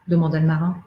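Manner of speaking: usual speaking voice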